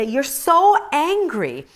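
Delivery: high, slow mocking husband saying you're so angry; husband's line. Husband to angry wife, slow mock chiding. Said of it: "he would just say".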